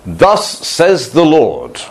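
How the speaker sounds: slow, grand King James proclamation; pompous prophet voice. Acted. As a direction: intone it like an old-style prophet booming from the pulpit. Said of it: "and they said".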